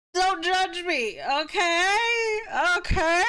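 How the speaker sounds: high, drawn-out protest; whiny mock defensiveness. Streamer fends off chat's teasing, whiny and defensive. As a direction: whine it as if near tears, playfully.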